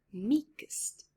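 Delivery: usual speaking voice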